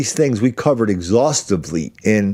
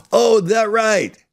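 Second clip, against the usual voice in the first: slow, much higher mock "oh, that right"; sarcastic drawl; 10 semitones higher, much slower than usual. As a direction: stretch it out, dripping sarcasm. Sarcastic reply in retold exchange.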